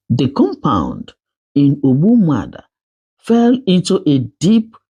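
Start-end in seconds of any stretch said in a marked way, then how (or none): none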